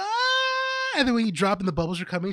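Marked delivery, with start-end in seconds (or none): 0.0-1.0 high mock shriek; imitated grandma scream. Imitating the grandma dropping on the ride, a high mock shriek. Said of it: "she's like".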